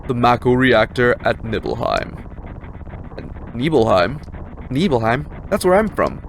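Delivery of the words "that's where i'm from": put-on higher, eager young recruit; young soldier voice.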